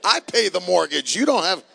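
loud and high, dad standing his ground; hammed-up outrage at a toddler; father playfully shouting back at his son; mock loud protest. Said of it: "I was like".

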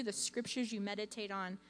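ordinary talk, nothing marked

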